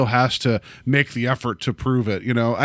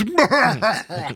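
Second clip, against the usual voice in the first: donkey-like guffaw; 9 semitones higher than usual. Retold or imitated. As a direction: imitate an obnoxious moviegoer's braying laugh. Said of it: "was guffawing like a donkey".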